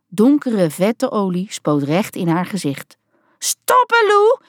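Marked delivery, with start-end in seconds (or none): 3.4-4.4 high, loud shriek at her daughter; a mother shrieking at her daughter to stop the engine. A narrator's reading. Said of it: "gilde ze".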